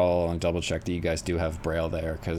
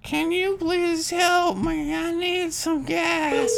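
put-on high, slow frail old lady; man doing a woman's voice; much slower than usual. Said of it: "that was a beautiful old lady voice".

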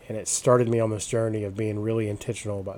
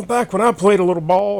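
boastful has-been athlete voice, pitched up and loud; bragging old jock; 9 semitones higher, 8 dB louder than usual. Retold or imitated. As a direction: puff up like a washed-up ballplayer. Mocking dudes telling war stories.

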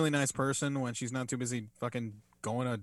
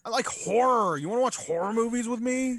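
higher-pitched, hammed-up horror fan pitch; mock gushing wrestler; 8 semitones higher than usual. Co-host mocking a wrestler who loves horror, mock gushing. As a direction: imitate an over-eager horror fan, high.

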